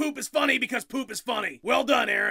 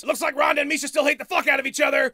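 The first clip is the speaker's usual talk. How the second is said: usual speaking voice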